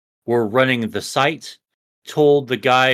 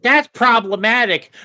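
high American-accented scolding; 9 semitones higher, 4 dB louder than usual. Shown as a demonstration. Showing the co-host the annoying version, American accent. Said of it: "in an American accent".

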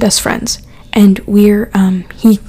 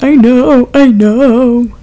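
tearful; 2 semitones higher, 6 dB louder, much slower than usual. Retold or imitated. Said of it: "And I'm crying".

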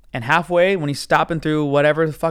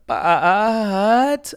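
long, higher mock 'But'; drawn-out defensive but; 5 semitones higher than usual. Driver about to make his excuse, drawn-out defensive voice. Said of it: "And then he does that".

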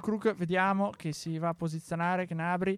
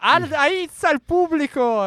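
excited; 8 semitones higher, 11 dB louder, slower than usual. Real, not acted. After the goal.

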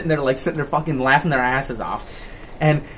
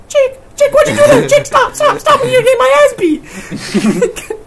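very high, loud squealing plea; hammed-up panic, begging a friend to stop; mock panicked pleading; 9 dB louder, slower than usual. Retells friend's panicked pleading from car.

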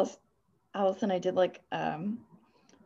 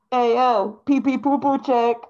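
hype voice; 4 semitones higher, 7 dB louder than usual. Retold or imitated. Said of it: "Or like".

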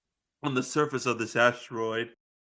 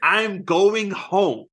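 slow determined; 4 semitones higher, 3 dB louder, much slower than usual. Retold or imitated. Declaring in role.

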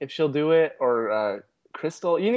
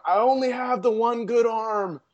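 higher, slow, whiny lament; mock lament; 8 semitones higher, 3 dB louder than usual. Imitating the weeping old man, slow high lament. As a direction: play the old man's self-pity, drawn out. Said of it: "he starts going".

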